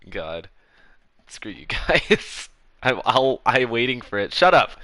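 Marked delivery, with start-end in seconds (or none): 1.3-2.5 drawn-out slow grumble; slow mock grumble. Real, not acted. Mock annoyance at chat. 4.3-4.7 loud high snap; yelling. Real, not acted. Yelling at chat.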